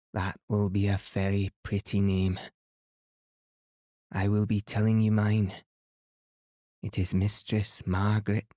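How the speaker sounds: put-on proud old Highland Scotswoman, a light lilt; lightly voiced Highland woman, dignified and grand; Highland accent. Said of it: "full of delightful Highland accent".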